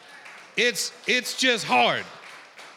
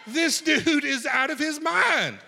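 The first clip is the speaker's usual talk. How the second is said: amazed shout; 8 semitones higher, slower than usual. Real, not acted. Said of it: "preacher marvels loudly".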